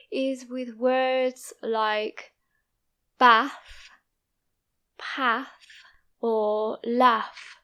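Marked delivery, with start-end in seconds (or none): 3.2-7.6 bath, path, laugh with a short flat a; short northern a in bath, path, laugh. Shown as a demonstration. Teacher listing bath words for learners. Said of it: "In my accent, we say path".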